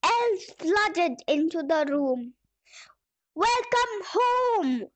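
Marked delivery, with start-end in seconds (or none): 3.3-4.9 high, slow joyful welcome; cheerful welcome. Acted. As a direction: greet him with delight. Elves greet tired Santa.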